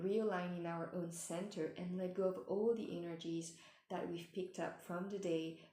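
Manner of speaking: ordinary talk, nothing marked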